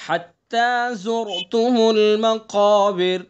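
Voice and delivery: slow melodic tilawat with long drawn vowels; slow Quran recitation. Shown as a demonstration. Teacher recites the surah for students to copy, slow melodic recitation. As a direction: chant each verse slowly, stretching the vowels. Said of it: "قاف کو کیسا پڑھیں گے؟".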